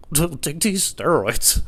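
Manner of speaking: slow, voiceless, stifled-laugh imitation; trying not to laugh. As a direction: squeeze out the words while holding in laughter. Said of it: "He's literally trying not to laugh".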